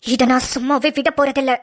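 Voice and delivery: low, cold villain threat; menacing threat. Acted. Brother threatening Aadhi over his sister's marriage. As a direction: growl the warning low.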